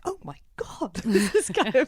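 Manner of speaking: hushed, high-pitched disbelief; acted astonishment. Reenacting astonishment at a discovery.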